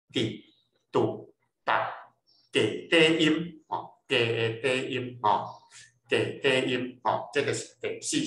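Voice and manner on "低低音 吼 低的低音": drawn-out demo. Shown as a demonstration. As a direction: spell out 低低音 slowly, leaning on it.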